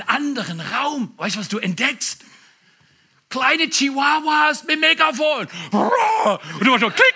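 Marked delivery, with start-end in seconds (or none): 3.3-7.1 high, loud mock megaphone yell; comic shout. Preacher joking about yapping chihuahuas, high loud shout. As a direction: blare it like a tiny dog with a megaphone.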